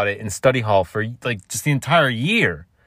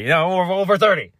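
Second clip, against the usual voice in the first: high, louder repeat; mock outrage; 10 semitones higher, 4 dB louder than usual. Real, not acted. Revealing his age to viewers, mock outrage. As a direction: hammer the age jokingly.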